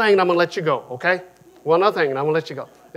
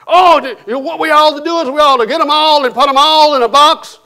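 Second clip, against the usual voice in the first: loud, high mocking jeer; mocking bigot; 9 semitones higher, 12 dB louder than usual. Dinner guy jeering at the parade on his phone. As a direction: sneer it like the men at dinner.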